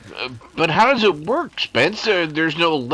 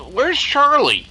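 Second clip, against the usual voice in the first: alarmed question; 7 semitones higher, 4 dB louder, slower than usual. Acted. Suddenly notices Charlie missing.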